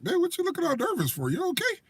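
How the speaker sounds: teasing concern. Retold or imitated. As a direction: poke fun while asking if he's okay. Teasing a nervous underage kid.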